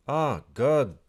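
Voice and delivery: loud, high cry of exasperation; frustrated outburst. Real, not acted. Coder yelling as Xcode fails again, loud frustrated cry. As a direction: blurt it out as the build breaks.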